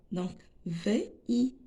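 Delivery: ordinary talk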